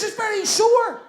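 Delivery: very high comic whimper; whining crybaby. Acted. Injured man to scolding sister, mock crybaby whine. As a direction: complain in a high, put-on crybaby voice. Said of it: "You're a big crybaby".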